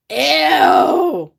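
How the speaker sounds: high, loud disgusted ew. Real, not acted. Host recoiling from chitlins, a loud disgusted ew. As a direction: recoil from the food with a sharp ew. Reacting to pig intestines.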